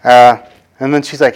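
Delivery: usual speaking voice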